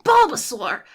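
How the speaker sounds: animated cartoon hero calling out; Ash. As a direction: pitch it up, full of effort. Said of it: "But with Ash, it's more like".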